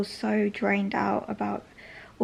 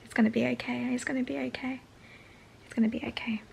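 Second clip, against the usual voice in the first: tearful whispered self-reassurance; nervously reassuring myself; 3 semitones higher, 6 dB quieter, faster than usual. Real, not acted. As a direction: soothe yourself through tears, softly. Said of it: "I had a moment".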